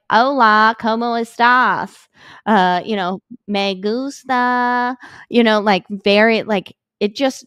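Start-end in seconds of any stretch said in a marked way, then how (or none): 0.1-2.1 hammed-up bad American accent in Spanish; mock awful accent in Spanish; terrible Spanish accent. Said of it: "like, so bad". 3.5-5.0 slow, high-pitched bad American accent in Spanish; mock awful accent in Spanish, drawn out; terrible Spanish accent. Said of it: "the accent was not accenting".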